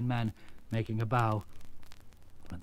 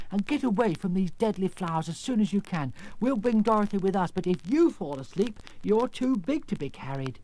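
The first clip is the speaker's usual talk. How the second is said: lightly voiced Scarecrow, pitched higher; urgent Scarecrow; 6 semitones higher, 3 dB louder than usual. Scarecrow warning the lion about deadly flowers, urgent. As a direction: press him to hurry off.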